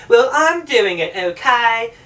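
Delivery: slow, insistent mock British; Anne Hathaway. Actress defying producers, badly faked British accent. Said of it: "my British accent is fucking terrible".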